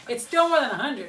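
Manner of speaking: said through a real laugh; giggling protest. Real, not acted. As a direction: laugh the words out while teasing. Teasing about the score, laughing.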